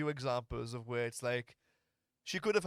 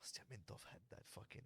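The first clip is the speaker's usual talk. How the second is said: breathy whisper, much softer; 4 semitones lower, 20 dB quieter than usual. Real, not acted. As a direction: whisper it like a secret. Sudden low aside.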